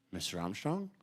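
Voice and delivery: timid approach. Retold or imitated. Timid fan approaching his hero.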